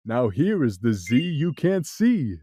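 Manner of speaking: the cat. Acted. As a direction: tease in the cat's low tone. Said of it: "said the cat".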